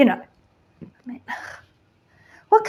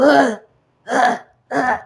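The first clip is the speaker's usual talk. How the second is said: put-on teen grunt; 4 dB louder than usual. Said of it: "their voices go all".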